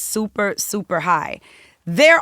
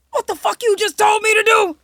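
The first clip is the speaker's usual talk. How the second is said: furious outburst; 14 semitones higher, 6 dB louder than usual. Retold or imitated.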